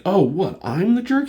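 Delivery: high, loud mock-offended comeback; indignant retort. Iron Man answers back to being told off, indignant retort. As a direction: act out wounded indignation, pitch climbing.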